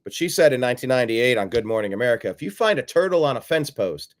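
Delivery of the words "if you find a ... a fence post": ordinary talk, nothing marked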